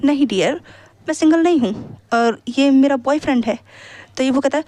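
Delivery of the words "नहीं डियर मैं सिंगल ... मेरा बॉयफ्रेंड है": plain reading voice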